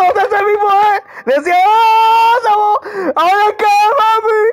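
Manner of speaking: high, stretched-out excited yells; excited shouting. Real, not acted. Excited shouting as match connects. (gritos agudos de emoción)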